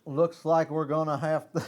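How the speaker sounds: slips into a Southern drawl while trying British; a cowboy twang where British was asked for; accidental Texas accent. Pretend. Trying British for the podcast, lands on Texan.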